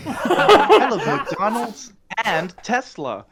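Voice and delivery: loud, high, drawn-out American caricature; American accent. Acted. Brit mocking American tastes, exaggerated American accent. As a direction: stretch and belt a hammed-up American accent. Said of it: "you do your American accent".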